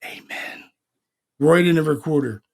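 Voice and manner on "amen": a soft whispered amen of a woman's voice; spooky whispered woman's amen; whispered ghost voice. Retold or imitated. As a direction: breathe amen like a ghost on a recorder. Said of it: "a woman's voice in the attic go".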